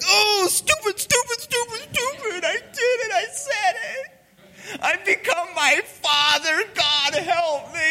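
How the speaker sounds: hammed-up high, loud tearful self-reproach; mock anguished wail. Father horrified at echoing his dad, hammed-up high wailing. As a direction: wail it out, stretching into a fake cry.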